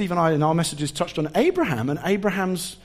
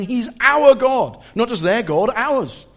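joyful declaration; 5 semitones higher, 5 dB louder, slower than usual. Retold or imitated. Nations worshipping, voiced declaration.

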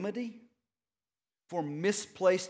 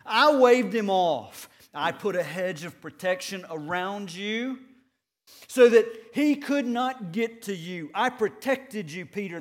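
louder, proud and emphatic; bold declaration; 7 dB louder than usual. Pretend. Preacher imagining Jesus defending Peter, loud and bold. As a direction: proclaim it like a protector standing up. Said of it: "I want Jesus to stand up and say".

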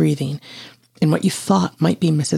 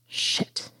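hushed, voiceless whimper; small scared curse, much softer; 10 dB quieter than usual. A narrator's reading. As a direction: whimper it under your breath. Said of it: "you whimpered".

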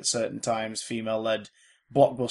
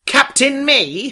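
mock heroic; 12 semitones higher, 10 dB louder, much slower than usual. Mock superhero self-title.

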